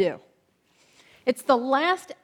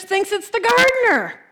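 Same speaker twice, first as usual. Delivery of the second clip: usual speaking voice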